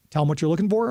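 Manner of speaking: usual speaking voice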